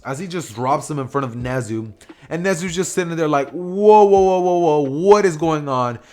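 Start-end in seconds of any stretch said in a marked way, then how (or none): 3.5-6.0 loud, higher run of whoas, drawn out; acted shock. Principal shown quirk-destroying bullets, acted shock. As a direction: imitate a stunned principal, loudly halting everything.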